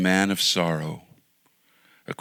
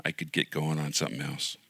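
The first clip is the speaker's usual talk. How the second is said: usual speaking voice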